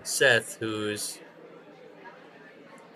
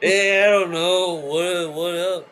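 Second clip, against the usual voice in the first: higher, louder, slow lazy dude voice; mock dude greeting; 7 semitones higher, 6 dB louder, slower than usual. Wrestler greeting the crowd, slow loud mock dude. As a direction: stretch it out like a too-cool guy.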